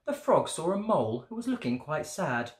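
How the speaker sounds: ordinary delivery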